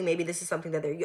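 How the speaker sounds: ordinary talk, nothing marked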